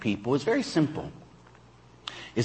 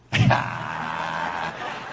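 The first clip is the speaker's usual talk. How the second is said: big laugh; 8 semitones lower than usual. Real, not acted. Laughs after punchline.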